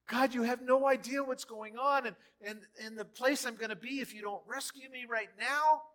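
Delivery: loud, high complaining cry; mock panicked believer; whiny desperate pray. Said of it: "much like the disciples".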